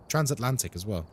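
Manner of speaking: ordinary talk, nothing marked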